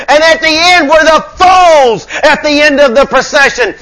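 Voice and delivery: high, loud, shouted sermon; full-force preaching. Real, not acted. Preacher telling congregation of the devil's defeat, preaching at full force. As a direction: proclaim the victory at full voice.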